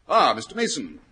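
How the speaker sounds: normal talking voice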